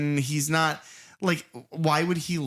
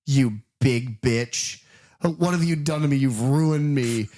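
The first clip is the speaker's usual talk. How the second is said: slow, louder mock tirade of insults; mock angry rant; 4 dB louder, slower than usual. Host voicing angry casino boss, slow mock tirade. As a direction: spit each insult out slowly like a furious boss.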